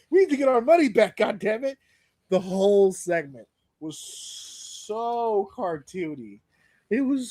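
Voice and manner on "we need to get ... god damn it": frantic Dom. Retold or imitated. Dom frantic in retold segment.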